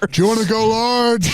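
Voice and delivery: upsell fries like a wrestler; Rock at McDonald's. Pretend. The Rock working the counter, a booming wrestler upsell. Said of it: "The Rock working at McDonald's".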